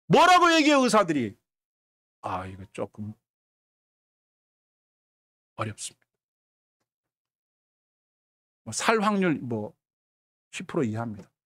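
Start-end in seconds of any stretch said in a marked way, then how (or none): 2.2-11.3 very low, soft, halting delivery; grave hesitant doctor. Acted. Doctor giving a patient poor odds, grave and hesitant. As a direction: break bad news reluctantly with long pauses.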